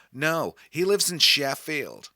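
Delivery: a lightly done, friendly American stranger; chatting to a boy from Liverpool in a light American accent; friendly American woman. Retold or imitated. American woman answering him.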